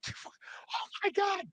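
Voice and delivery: high, soft, drawn-out gasp of words; soft falsetto astonishment, slowly; imitated hushed awe. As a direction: mimic an awestruck crowd under the breath. Imitating backstage crowd's awed reaction.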